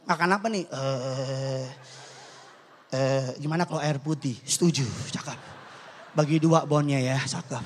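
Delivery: low-pitched, acted restaurant chat; stingy diners. Stingy friends ordering at a restaurant, low put-on voices. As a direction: drop low and play penny-pinchers agreeing on a cheap order.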